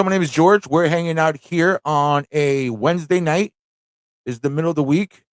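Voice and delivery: ordinary talk, nothing marked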